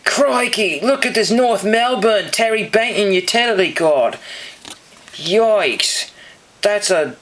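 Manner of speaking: high, hammed-up Australian accent; bad Aussie accent. Card collector showing off a North Melbourne card, bad Aussie accent. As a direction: put on a cheesy Aussie accent, pitched well up. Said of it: "Aussie accent is not really that great".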